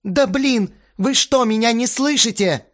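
high, loud, insistent complaint; outraged tirade. A narrator's reading. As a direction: rail at those who won't listen. Astarot yells at the band. (возмущённая тирада, громко и высоко)

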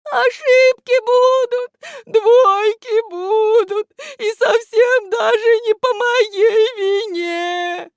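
very high, loud, drawn-out wail; рыдающий голос. A narrator's reading. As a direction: wail each phrase through tears. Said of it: "в голосе у этого невидимого слышались слёзы". (громко причитать сквозь слёзы)

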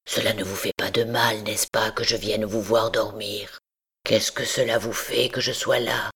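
low, hushed hunchback voice, half whispered; Quasimodo, rough but very gentle. A narrator's reading. Hunchback reassuring the frightened girl, low and gentle. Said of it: "une rude voix qui disait très doucement".

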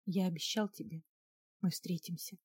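soft, tender near-whisper. A narrator's reading. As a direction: murmur a promise close to the ear. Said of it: "прошептал".

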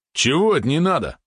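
high-pitched outraged challenge; indignant. A narrator's reading. Valeron scolds the reluctant spider, indignant. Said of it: "возмутился Валерон".

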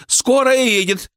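high, drawn-out call; slow, high-pitched calling out; shout from crowd. A narrator's reading. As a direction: spread the news in a high cry. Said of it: "закричал кто-то из толпы".

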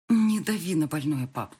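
ordinary delivery

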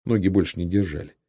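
plain reading voice

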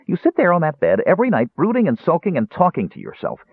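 high, reproachful nagging; wounded complaint. A narrator's reading. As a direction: scold your friend with hurt pride. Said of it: "Tass complained, wounded".

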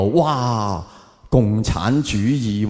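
ordinary talk, nothing marked

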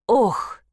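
sharp gasp of pain; clutching the heart, gasp; having a heart attack. A narrator's reading. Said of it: "Старуха схватилась за сердце".

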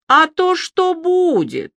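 high, ringing, close to breaking; shrill strained voice. A narrator's reading. Alya taunts Ilya mid-hysteria, high ringing strained voice. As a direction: let the voice ring and strain near cracking. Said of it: "как надрывно звенит её голос".

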